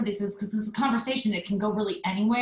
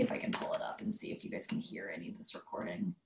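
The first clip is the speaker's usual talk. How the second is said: whisper; 13 semitones lower, 11 dB quieter than usual. Real, not acted. Whispered aside.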